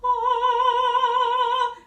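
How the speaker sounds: high, loud, open operatic "oh"; yawning opera tone. Shown as a demonstration. Teacher demonstrating opera quality with a yawned "oh". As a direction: sing "oh" as if starting a yawn. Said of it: "that's how you get to it, is the yawn".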